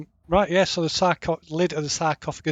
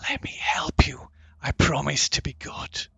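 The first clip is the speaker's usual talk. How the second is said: soft, hissing whisper; sibilant whisper; 4 dB quieter than usual. Acted. Staff's serpent voice offers help to its new holder, sibilant whisper. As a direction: whisper it into the listener's ear. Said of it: "a sibilant voice whispers in your ear".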